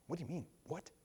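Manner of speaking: softly baffled, half to himself; quiet, confused aside; hushed puzzlement. Retold or imitated. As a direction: mutter the question like you can't follow. Outsider puzzled by church jargon.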